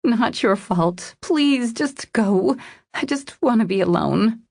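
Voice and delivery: high, distressed plea; voice cracking. A narrator's reading. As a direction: beg for solitude, close to tears. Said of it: "her voice cracking".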